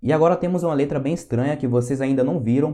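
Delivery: normal talking voice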